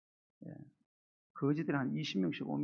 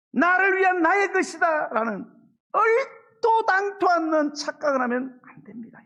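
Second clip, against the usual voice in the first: loud, high, fervent sermon delivery; full-force preaching; 12 semitones higher, 10 dB louder than usual. Acted. (목소리를 높여 힘차게 설교하듯)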